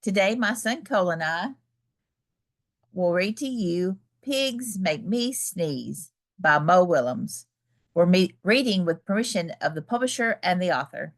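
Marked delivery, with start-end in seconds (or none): none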